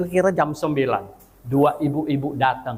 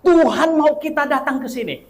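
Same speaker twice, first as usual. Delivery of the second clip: earnest reassurance; 11 semitones higher, 4 dB louder than usual. Retold or imitated. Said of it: "saya bilang apa sama mereka".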